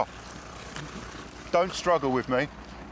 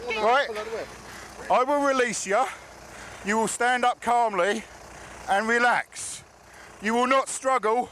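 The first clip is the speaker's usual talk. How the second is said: firm commanding voice; 10 semitones higher than usual. Real, not acted. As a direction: lay down the law firmly. Officer giving firm orders to suspect.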